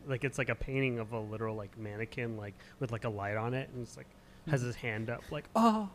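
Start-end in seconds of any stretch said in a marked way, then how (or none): 5.5-5.9 high, loud fake gasp; mock surprised gasp. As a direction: gasp theatrically. Mannequin pose sound.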